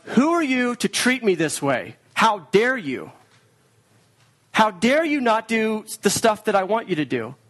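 a lightly acted bitter accusation; acidic accusation. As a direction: hurl it at God like an accusation. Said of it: "acidic accusation against God".

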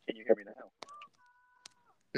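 slow, muffled through a mask; muffled and distant. Real, not acted. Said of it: "you still sound muffled".

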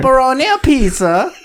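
high, sing-song cartoon Italian; mock Italian accent. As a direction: ham up a high-pitched Italian caricature. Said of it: "Can I make fun of Italian people?".

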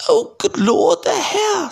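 frantic high-pitched woman. A narrator's reading. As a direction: cry out in a flustered falsetto. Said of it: "said Aunt Petunia frantically".

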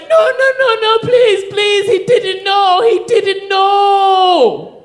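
high, loud wailing imitation; hammed-up desperate begging, last word drawn out in a wail; crying, frantic pleading. Crying stripper begs guard to spare man, high mock wail. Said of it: "She starts crying even more".

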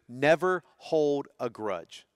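never hold a grudge drawn out very slowly, never leaning in; slow emphatic rule. Shown as a demonstration. Pastor giving the family's second rule, very slow and weighty. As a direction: lay the second rule down word by word. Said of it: "And number two".

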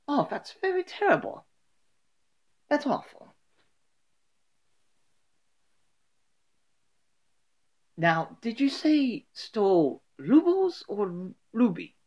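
high, soft put-on Russian voice; amateur Russian character, pitched well up; Russian accent. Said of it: "the Russian guy being like".

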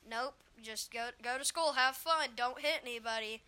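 ordinary talk, nothing marked

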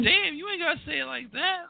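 mock girl voice. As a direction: play her as taken aback and defensive. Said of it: "And she said".

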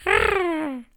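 tiny high squeaky roar; copycat roar. Acted. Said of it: "a Millie também rugia". (rugido agudo e pequenino)

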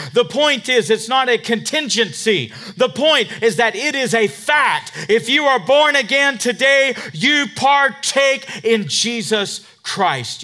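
preaching at full force. Acted. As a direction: drive each point home to the church. Sustained loud preaching.

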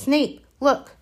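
urgent, sharp call. A narrator's reading. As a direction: point it out urgently. Hermione urgent.

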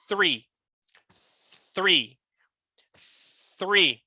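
three with the TH sound, slow and falling; soft TH demo. Shown as a demonstration. Teacher showing students the TH in three. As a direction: start three with the tongue between the teeth, soft TH. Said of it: "o sonzinho do T H".

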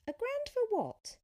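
high, loud incredulous question; startled disbelief. A narrator's reading. Sophia baffled by the cyclist's price, startled. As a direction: jump up in pitch, baffled.

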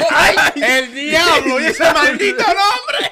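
drawn-out, high exclamation of disbelief; scandalized, stretched-out amused yell; laughing outburst. Real, not acted. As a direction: cry out slowly through laughter. Mock outrage at the name.

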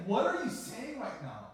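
high, loud, frantic questions; panicked disbelief. Retold or imitated. Pastor frantically questioning the grandmother on the phone, panicked. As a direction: cry out in shock at the news.

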